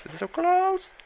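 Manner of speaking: high squeaky burst of nerves; tense squeal. Real, not acted. A nail-biter match, high nervous squeal.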